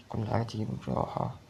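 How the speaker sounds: low soothing mantra to himself; soft self-calming. Real, not acted. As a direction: murmur it to keep calm. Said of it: "طب بالراحة".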